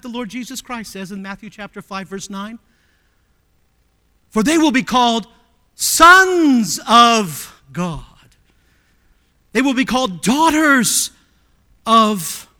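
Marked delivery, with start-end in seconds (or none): 4.3-12.5 louder, slow, drawn-out quote; preached scripture; emphatic scripture reading. Acted. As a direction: proclaim the verse.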